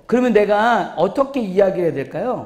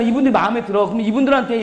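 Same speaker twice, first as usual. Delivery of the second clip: usual speaking voice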